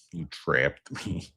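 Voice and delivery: slow, soft put-on accusation; film villain impression. Quoting the card-game loser, slow soft impression. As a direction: draw it out softly, in character.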